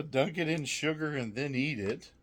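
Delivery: normal talking voice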